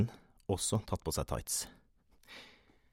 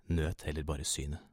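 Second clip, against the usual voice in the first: usual speaking voice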